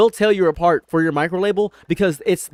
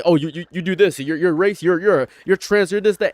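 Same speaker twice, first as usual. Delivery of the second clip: mimicking angry insults; 4 semitones higher, 4 dB louder than usual. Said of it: "You're trying to say".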